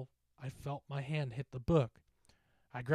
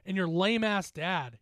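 high, raised-voice insults; shouted taunt; 7 semitones higher, 5 dB louder than usual. Acted. Bully holding a teammate in a headlock, shouting. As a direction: hurl the insults loudly at him. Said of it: "he shouted at me".

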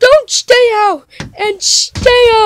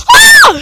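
short, very high loud scream; play scream; 13 semitones higher, 6 dB louder than usual. Pretend. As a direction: scream in pretend fright. Scream in acted chase.

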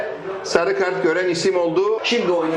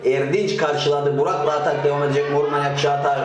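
normal talking voice

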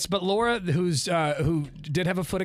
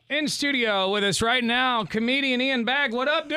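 announcer voice; 8 semitones higher than usual. Acted. Host introduces guest on air.

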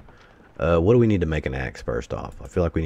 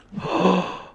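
shocked gasp; 14 semitones higher than usual. Real, not acted. House destroyed in the game.

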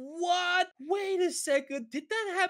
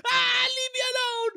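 scared shout; 8 semitones higher, 4 dB louder than usual. Acted. Chased by monster fish.

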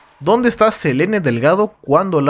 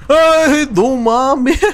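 startled shriek; 11 semitones higher, much slower than usual. Real, not acted. Sudden scare in game.

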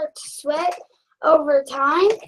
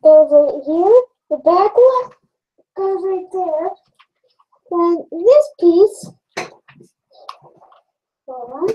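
muffled behind face mask; speaking inside mask; through a respirator mask; 3 dB louder than usual. Real, not acted. Seen 1: Respirator covers mouth and nose while he tightens head straps.

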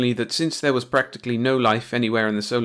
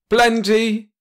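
loud, high bellowed answer; roaring; 12 semitones higher, 8 dB louder than usual. A narrator's reading. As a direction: roar it at the hero. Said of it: "roared Metternich".

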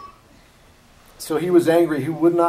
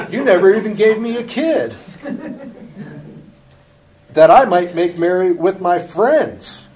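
loud, high, half-laughing reproach; laughing resentful son; 7 semitones higher, 10 dB louder than usual. Acted. Preacher voicing the son's grudge, loud and laughing. Elder son protesting to father.

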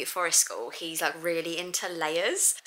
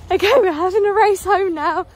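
playful race call; 8 semitones higher, 9 dB louder than usual. Real, not acted. Racing the kids home.

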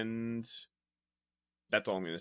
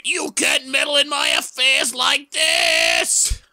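high, slow, loud villain cry; villain shout; 11 semitones higher, 4 dB louder, slower than usual. Acted. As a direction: bellow the villain's outrage. Villain shouts his line.

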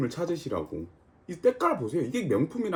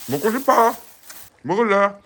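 teasing the dog; 6 semitones higher, 8 dB louder than usual. Real, not acted.

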